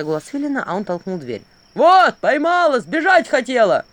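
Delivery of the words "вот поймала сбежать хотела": loud, slow barked report; barking. Acted. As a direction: bark like a guard. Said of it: "рявкнул он".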